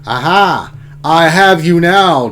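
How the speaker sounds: loud, slow triumphant gloat; booming villain triumph. Acted. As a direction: crow over the cornered enemy like a movie villain. Gloating villain line in game.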